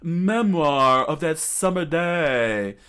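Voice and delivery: loud, slow, high proclamation; theatrical battle cry; attack call. Acted. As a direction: draw out the move name grandly. Villain calls out attack name.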